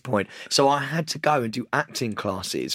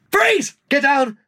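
high, loud, urgent police order; acted police shout with jeopardy; policeman's shouted command; 11 semitones higher, 5 dB louder, much slower than usual. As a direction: shout it like an armed officer bursting in. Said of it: "show a little bit of jeopardy in your voice".

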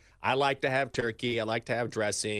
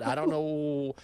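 ordinary delivery

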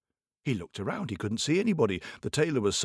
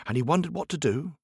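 plain reading voice

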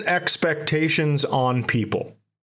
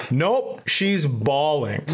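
ordinary delivery

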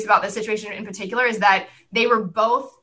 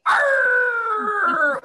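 high growled pirate arr; mock pirate cry, pitched way up; 13 semitones higher than usual. Four-year-old scaring her little sister, playful pirate arr. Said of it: "which is a pirate".

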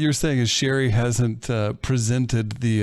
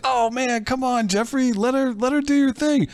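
high, coaxing plea; pleading with Jeffrey; 12 semitones higher than usual. Retold or imitated. The hosts urging Jeffrey to let his mom post, high and coaxing. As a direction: beg him lightly in a higher voice. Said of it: "we're like".

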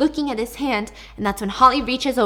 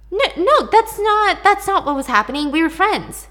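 higher, louder flustered protest; panicked denial; 8 semitones higher, 7 dB louder than usual. Acted. Suspect denies under interrogation.

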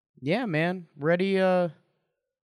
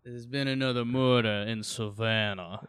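put-on southern accent. Co-host quoting an episode of The Office, southern accent. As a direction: quote it in a molasses-thick southern accent. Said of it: "you're kinda doing a southern accent".

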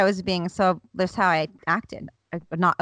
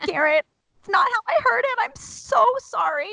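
high apologetic whine; 14 semitones higher, 4 dB louder than usual. Retold or imitated. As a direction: beg forgiveness in a thin high voice. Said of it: "And I'm like".

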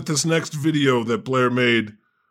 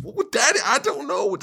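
higher, laughing ditzy daughter voice; quoting the video's ending in a high, giggly voice; imitating the daughter; 9 semitones higher than usual. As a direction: mimic the clueless daughter. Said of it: "I just love the way these videos end".

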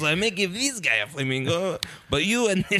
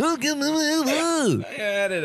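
very slow, high mock Mexican accent; 10 semitones higher, much slower than usual. Insisting he had the accent, in a stretched-out mock Mexican accent. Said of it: "can't do an accent".

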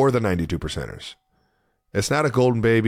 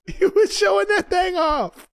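laughing. Real, not acted. Laughing at dance memory.

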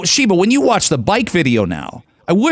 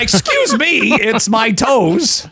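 much higher, drawn-out comic protest; mock protest; 8 semitones higher, much slower than usual. Co-host defends his toes in jest, high mock protest. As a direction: protest in a hammed-up high pitch, stretching the last words.

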